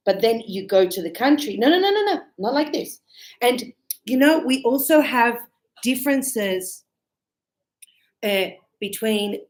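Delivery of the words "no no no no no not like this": high, rapid-fire run of no's; waving away a learner's mistake; imitated local objecting, pitch jumping up; mock correcting.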